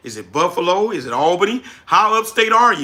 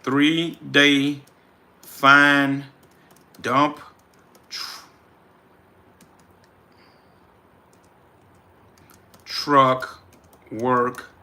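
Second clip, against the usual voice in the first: word by word with long gaps, a bit lower; 4 semitones lower, much slower than usual. Real, not acted. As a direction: read it out piece by piece. Dictating the challenge name again.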